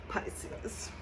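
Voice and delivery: breathy whispered name. Real, not acted. Tarot reader savoring the Pisces card, whispered. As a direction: whisper it like a secret.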